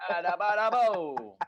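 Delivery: loud mock Italian-American gibberish; hammed-up mobster babble syllables; vaguely Italian noises. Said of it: "All these noises".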